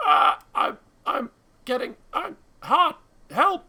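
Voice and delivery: very high, very loud panicked screams; burning man screaming. Acted. Man catching fire in the carriage, screaming for help.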